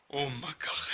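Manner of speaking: southern French exclamation of awe; surprised. Real, not acted. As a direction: blurt it out in amazement. First sight of the rare card.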